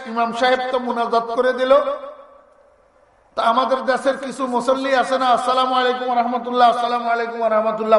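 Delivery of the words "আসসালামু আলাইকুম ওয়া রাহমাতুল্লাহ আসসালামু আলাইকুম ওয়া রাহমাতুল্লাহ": rattled off very fast; mock rushed end of prayer; hurried prayer salams. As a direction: race through the closing salams in mimicry. Imitates worshippers rushing the closing salam.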